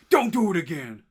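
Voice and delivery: acted-out stern telling-off; retold warning. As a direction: warn him off in a playful imitation. Acting out warning after punch.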